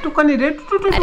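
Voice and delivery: high singsong mock Garhwali accent. Husband repeats his mocking Garhwali, high singsong. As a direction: tease it in a rising Garhwali sing-song. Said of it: "ऐसे तुम्हारा है".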